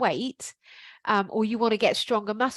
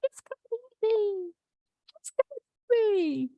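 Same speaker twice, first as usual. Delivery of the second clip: packet calling; 12 semitones higher than usual. Pretend. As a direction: lure them in like a talking biscuit packet. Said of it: "you hear the packet calling".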